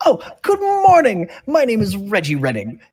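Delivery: very high, loud chirpy gnome salesman; gnome shopkeeper. Acted. Gnome introduces himself to the party, high and chirpy. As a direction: gush like a flustered little shopkeeper. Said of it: "this gnome looks at you and goes".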